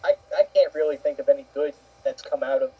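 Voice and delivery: ordinary talk, nothing marked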